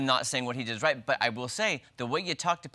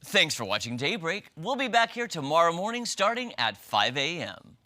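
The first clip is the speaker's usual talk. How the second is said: bright, louder newscaster read; news anchor voice; 4 semitones higher, 4 dB louder than usual. Shown as a demonstration. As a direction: sign off like a morning news anchor. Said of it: "do your best news anchor voice".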